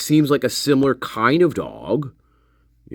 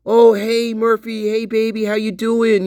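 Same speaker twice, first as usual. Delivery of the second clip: high, gushing hello to a pet; doting dog greeting; 7 semitones higher than usual. Acted. Imagined dog lover greeting Murphy, doting. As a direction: greet the dog in a raised, affectionate pitch. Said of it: "he's gonna be like".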